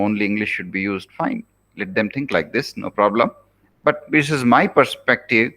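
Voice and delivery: ordinary delivery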